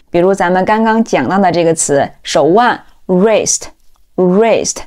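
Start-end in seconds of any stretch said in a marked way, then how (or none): 3.1-4.8 "wrist" said slowly as "rist"; silent W. Shown as a demonstration. Teacher recalling the example word, "wrist" with silent W. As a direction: start "wrist" straight on the R. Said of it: "开头的W是不发音的".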